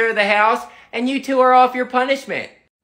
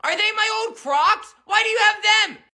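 put-on high-pitched shocked kid; 8 semitones higher than usual. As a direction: squeak it like an outraged boy.

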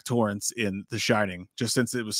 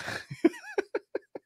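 shrill burst of laughter; helpless high laugh; high squealing laugh; 6 dB quieter than usual. Real, not acted. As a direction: crack up into a squeal. Breaks down laughing.

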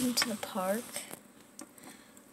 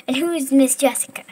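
indignant mom; 3 semitones higher, 12 dB louder, faster than usual. Acted. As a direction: fire it off indignantly. Mom demands who the girl is.

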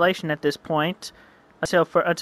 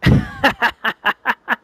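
hammed-up gloating villain laugh; evil laugh; 6 semitones higher, 6 dB louder than usual. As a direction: cackle at your own scheme.

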